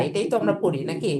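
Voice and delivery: ordinary delivery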